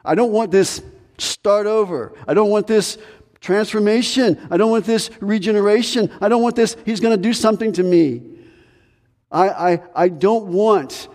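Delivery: high-pitched mock protest of a reluctant believer; protesta recitata. Acted reluctant believer refusing change.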